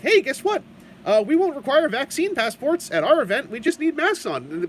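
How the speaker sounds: high, chirpy announcement voice; mock cheerful PR voice. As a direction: sell it like a bubbly press release. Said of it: "in a very friendly, cheerful way".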